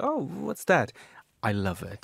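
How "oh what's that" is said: sudden much higher puzzled exclaim; mock surprise. Host recalling his first hearing of Finland's song, surprised and much higher. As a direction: play the startled first listen. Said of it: "I was like".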